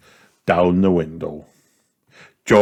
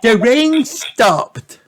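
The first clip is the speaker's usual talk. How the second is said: much higher, slow joyful announcement; excited cry; 4 dB louder, much slower than usual. A narrator's reading. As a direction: Peppa thrilled the rain is over. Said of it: "cried Peppa".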